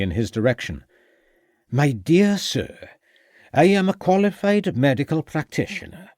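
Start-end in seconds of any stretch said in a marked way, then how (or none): 1.7-6.1 a hint of Welsh pride, standing on his dignity; offended Welsh doctor. A narrator's reading. Offended Welsh doctor asserts himself.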